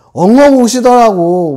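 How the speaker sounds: high, loud, drawn-out telling of sobbing; loud retold outburst. Colleague recalling the director's sudden tears, loud and drawn out. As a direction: stretch it out loudly, playing up the weeping.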